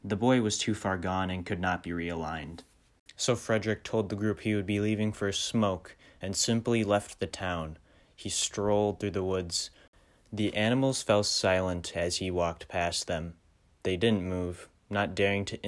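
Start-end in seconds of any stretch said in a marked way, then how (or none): none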